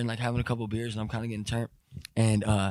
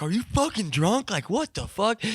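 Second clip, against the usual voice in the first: angry dad; 8 semitones higher than usual. Retold or imitated. Dad confronts drunk son.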